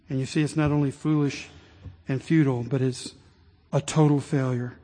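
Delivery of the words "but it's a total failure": ordinary delivery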